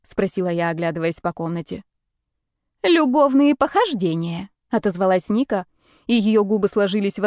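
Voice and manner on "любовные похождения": drawn-out, higher-pitched jealous remark; slow it down, pitched up, with mild jealousy; a touch of jealousy. A narrator's reading. Ника answering where Марина is, a touch jealous. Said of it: "В голосе прозвучала ревность".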